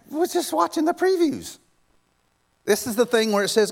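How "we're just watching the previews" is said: very high, squeaky-pitched protest; comic exasperation. Acted. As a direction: push it right up high in disbelief. Comic exasperation at Moses' impatience.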